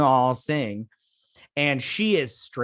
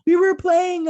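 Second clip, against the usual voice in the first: much higher, strained, whimpering voice; mock crying; 8 dB louder than usual. Crying ensign explains herself under interrogation, mock sobbing. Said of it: "straight up crying".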